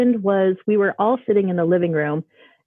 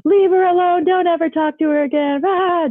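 high, loud mock-frantic yell; flipping out; 11 semitones higher, 5 dB louder than usual. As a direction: yell a frantic warning off, imitating a panicked mother. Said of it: "flipped out on him".

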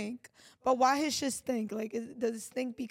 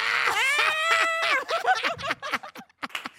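shrieking laugh; 4 dB louder than usual. Real, not acted. Laughing at her exes joke.

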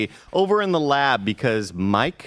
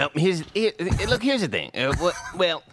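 higher-pitched stammering impression; Fran; 6 semitones higher than usual. Co-host mimicking a colleague on inventory management, high stammering impression. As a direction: stumble over the words in a high voice. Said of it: "Oh, that was a perfect Fran".

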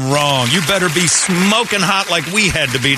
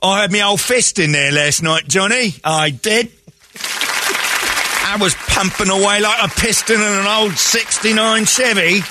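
pitched-up broad Cockney; put-on Cockney voice; Cockney accent; 7 semitones higher than usual. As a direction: brag like a crude Cockney caricature. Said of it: "Yeah, she's Cockney".

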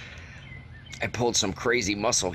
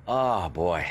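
ordinary talk, nothing marked

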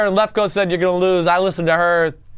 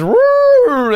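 excited woo; 6 dB louder than usual. Real, not acted. Hyping his pick.

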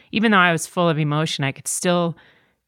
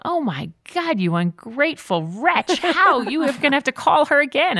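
mock self-scolding; 9 semitones higher than usual. Scolding herself in her head.

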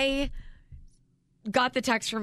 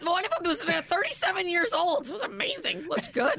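mock awe; 6 semitones higher than usual. Mock awe at parrot show.

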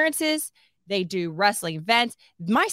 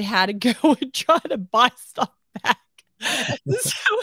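real laughter breaking into the words, louder; laughing while talking; 3 semitones higher, 5 dB louder than usual. Real, not acted. Wife telling on her over-generous husband, laughing. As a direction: tell it through bursts of laughter.

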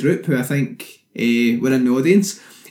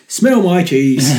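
slow, drawn-out quoted catchphrase; a light impression of the comedian; 3 semitones higher, 4 dB louder, slower than usual. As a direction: say it slowly like the comedian's famous line. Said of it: "He's the guy who he says".

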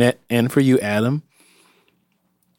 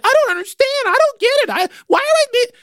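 high, whining confusion; whiny confused voice; much faster than usual. Retold or imitated. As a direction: whimper it like a lost kid. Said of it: "kinda like".

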